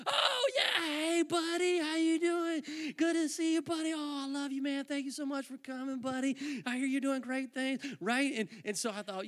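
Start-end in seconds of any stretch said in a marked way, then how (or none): 0.1-7.8 high-voiced, gushing hello, easing down; imitating an over-friendly pastor; effusive pastor greeting. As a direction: greet him like a long-lost buddy. Pastor effusively greets him, retold.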